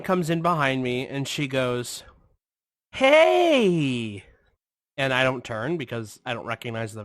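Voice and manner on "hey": high, drawn-out imitation hail; mock calling out. Woman behind him in line, high drawn-out mock call. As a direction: call out high like a chatty stranger.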